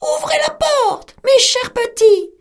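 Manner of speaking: high, loud fake mother voice; the wolf calling sweetly through the door; wolf faking mother goat at the door, high; wolf's sweet call. A narrator's reading. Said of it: "et cria".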